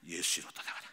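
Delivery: low, hushed rebuke under the breath; inner rebuke. Retold or imitated. Pastor silently rebuking the spirit during a quarrel, hushed low command. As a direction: command the spirit to leave, barely aloud. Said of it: "했더니 속으로".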